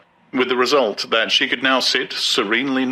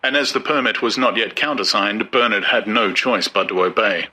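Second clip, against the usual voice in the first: plain reading voice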